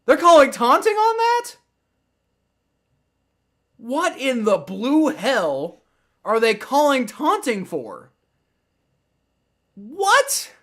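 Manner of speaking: loud, high-pitched incredulous outburst; outraged disbelief. Real, not acted. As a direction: protest a baffling call, voice shooting up. Outraged at taunting call.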